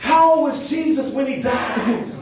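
loud, high and slow; shouted question. Retold or imitated. Classmate shouting the punchline question at Jack. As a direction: hammer the question home.

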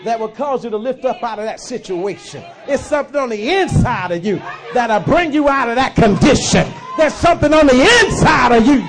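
shouted, building sermon climax; preaching at full force. Acted. Preacher shouts to the congregation at full force. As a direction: drive it home to the church.

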